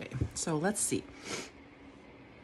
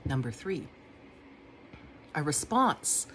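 normal talking voice

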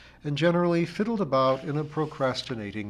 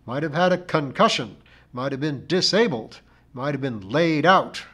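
louder, portentous list of injuries; intoned; 3 semitones higher, 8 dB louder than usual. A narrator's reading. Missus Pringle lists what might have befallen her, intoned. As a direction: intone each possible disaster. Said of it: "intoned Missus Pringle".

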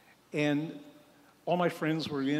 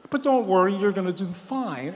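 much louder, brightly offhand; breezy reassurance; 4 semitones higher, 7 dB louder than usual. Retold or imitated. Surgeon cheerfully reassuring the frightened patient, louder and breezy. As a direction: toss it off cheerily.

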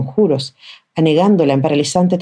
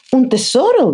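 much higher, thrilled exclamation; excited cry; 9 semitones higher, slower than usual. A narrator's reading. Children crying out together about treasure. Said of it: "gritaron todos a la vez".

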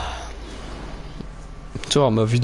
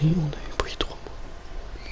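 whisper; 2 semitones higher, 10 dB quieter, much slower than usual. Real, not acted. Whispered aside.

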